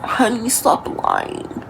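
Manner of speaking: slow, soft mocking mom voice; dismissive parent. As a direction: brush it off like a mom. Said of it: "you're saying".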